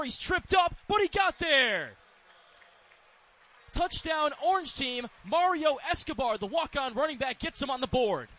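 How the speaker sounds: high, excited call building to a peak; touchdown play-by-play. Real, not acted. Announcer calls the fourth-down touchdown, excited play-by-play. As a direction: build sharply to the score and stay high.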